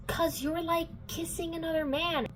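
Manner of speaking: high, whiny-mad imitation; mock indignation. Simon mad at Clary for kissing Jace, mock indignation. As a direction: imitate a jealous boy's high complaint. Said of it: "Simon's also mad at her".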